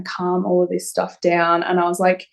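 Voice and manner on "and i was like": ordinary talk, nothing marked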